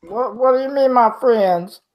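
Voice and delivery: high squeaky worried voice; nervous kobold. Acted. Kobold dodging Val's question, high nervous squeak. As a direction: play dumb in a tiny frightened voice. Scared kobold answers Val.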